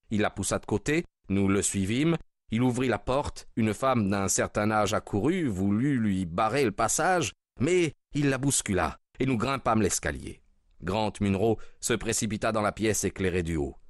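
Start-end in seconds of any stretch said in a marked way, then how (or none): none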